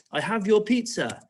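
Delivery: lightly acted snapping. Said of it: "he's snapping".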